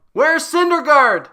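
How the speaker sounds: sudden high, loud call out; mock yell. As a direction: bellow the question like searching a crowd. Running gag, yelling for missing player.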